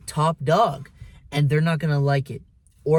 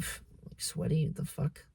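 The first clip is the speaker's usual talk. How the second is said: breathy scoffing whisper; whispered under his breath; 10 dB quieter than usual. Retold or imitated. Coworker mocking the narrator's sweat, whispered under breath. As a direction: whisper it like a snide aside. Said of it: "made a comment under his breath".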